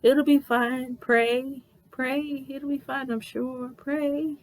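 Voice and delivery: small high voice, gingerly consoling; awkward comforting. Retold or imitated. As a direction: imitate a supervisor nervously soothing a crier. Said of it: "awkward, awkward pats on the back".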